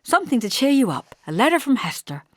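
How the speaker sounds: bright. A narrator's reading. Daughter handing ill mother a letter, bright. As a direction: sell the good news brightly. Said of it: "I said brightly".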